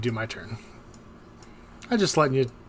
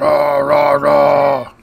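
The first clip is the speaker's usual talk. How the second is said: much louder brute bellowing; Strong Mad roar; 11 dB louder, much slower than usual. Acted. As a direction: roar it like a dumb muscle brute. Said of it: "strong mad".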